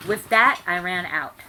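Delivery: ordinary delivery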